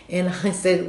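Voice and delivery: ordinary talk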